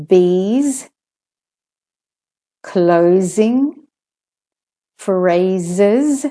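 "bees, closing, phrases" with voiced z; word-list model, clear z in "bees, closing, phrases". Shown as a demonstration. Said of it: "where the S says Z".

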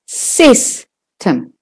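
"system" split, SIS held long; stress on SIS. Shown as a demonstration. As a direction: hold the sys of "system", then a brief tem. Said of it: "is louder and longer".

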